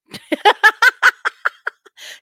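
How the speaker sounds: loud, high burst of laughter. Real, not acted. Vlogger laughing at the nurses tattoo excuse, high burst of laughter. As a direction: crack up laughing at the absurd defense.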